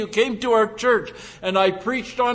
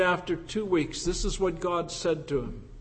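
usual speaking voice

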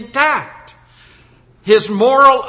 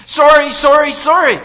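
pleading, higher and louder, like a weeping sinner; mock tearful sorry; 8 semitones higher, 7 dB louder than usual. Preacher voices a weeping sinner's plea. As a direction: beg forgiveness in a rush of tears.

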